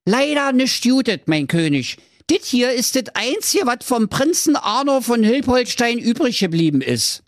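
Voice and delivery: high, annoyed servant voice; fed up with repeating his name; exasperated servant. Acted. Servant correcting the forgetful king, high and annoyed. Servant reports bad news.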